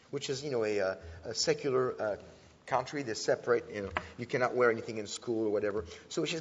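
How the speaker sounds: ordinary talk, nothing marked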